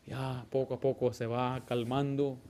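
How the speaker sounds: ordinary talk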